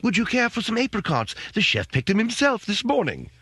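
high, obliging butler offering fruit; mock posh servant voice; servile waiter voice. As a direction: play the fawning resort waiter. Playing a butler at the resort.

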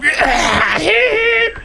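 mock high wheezy laugh; exaggerated emphysema cackle; imitated emphysema laugh. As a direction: mimic someone's squealing, wheezy laugh. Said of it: "that was the most emphysema laugh. It was like".

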